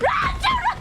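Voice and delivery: frantic shout. Real, not acted. Cheering character to flee.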